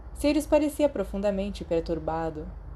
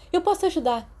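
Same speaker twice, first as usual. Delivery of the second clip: much higher, eager squeak of a voice; eager to be included; 11 semitones higher, faster than usual. Acted. Peter begs his friends to let him help, eager and high. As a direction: jump in, desperate to belong. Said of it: "ansioso para ser incluído".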